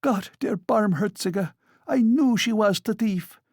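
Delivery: high, exclaiming landlady; outraged cry. A narrator's reading. German landlady denounces Sonia as thief, crying out. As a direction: cry it out, pitched far up. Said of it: "cried Amalia Ivanovna, throwing up her hands".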